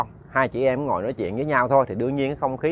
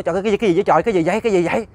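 higher-pitched startled outburst; acted shock, urgent and raised; alarmed; 5 semitones higher, much faster than usual. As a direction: blurt repeated questions in mock panic. Husband retold seeing dead wife risen.

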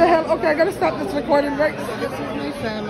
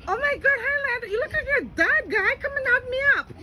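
delighted squeal, much higher; thrilled to see the little one again; excited greeting; 9 semitones higher, faster than usual. Real, not acted. Greeting nephew she hasn't seen.

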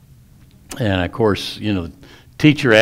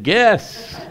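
put-on cheeky kid; 10 semitones higher than usual. Cheeky kid answers teacher.

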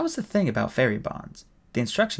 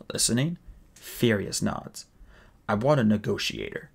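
usual speaking voice

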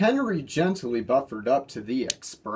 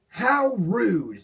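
offended; 8 semitones higher, 3 dB louder than usual. A narrator's reading. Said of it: "said one nine nine".

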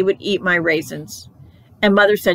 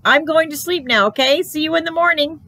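high sing-out to a parent; child calling goodnight; 9 semitones higher than usual. A narrator's reading. Child putting mother off at bedtime, high goodnight call. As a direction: pitch it up like a little kid's goodnight.

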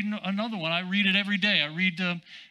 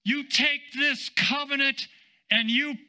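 raised, stretched-out exhortation; emphatic preaching; 7 semitones higher than usual. Real, not acted. Preacher urging believers to live by the covenant, emphatic preaching. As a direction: urge the congregation on, leaning long on key words.

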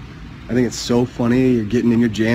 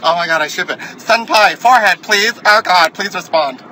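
high, louder begging fan voice; mock fan plea; 5 semitones higher, 5 dB louder than usual. Mocking fans begging the forehead, a high loud plea. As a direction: beg like a smitten fan.

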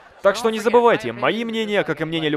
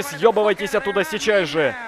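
ordinary talk, nothing marked

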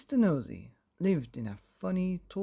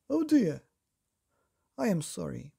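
higher mock-sorry painter; Mister Brush; 3 semitones higher, 5 dB louder than usual. A narrator's reading. Painter Mister Brush to Mister Nosey, mock apology. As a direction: fake apology, hammed-up. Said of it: "said Mister Brush".

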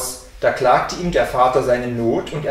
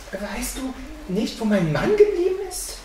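high-pitched, hesitant worried question; a touch of fear, climbing high; anxious wife; 9 semitones higher than usual. A narrator's reading. Wife asks the boy where her husband is, anxiously. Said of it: "Da ward ihr endlich Angst".

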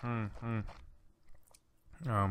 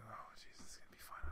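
breathy whispered aside; 17 dB quieter, faster than usual. Real, not acted. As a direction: whisper it to yourself before the game. Before playing.

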